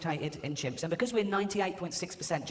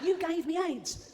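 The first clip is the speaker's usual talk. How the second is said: very high, slow squealed outrage; drawn-out mock accusation; 13 semitones higher, much slower than usual. Patient accuses the chimp, drawn-out mock outrage. Accusation repeated in acted quarrel.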